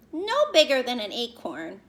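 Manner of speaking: ordinary talk, nothing marked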